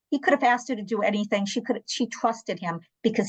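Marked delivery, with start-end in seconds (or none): none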